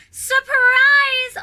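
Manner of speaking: very loud, high shout. A narrator's reading. Snowman shouting to the crowd, very loud and high. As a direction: throw it out to the whole crowd. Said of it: "Olaf shouted to the crowd".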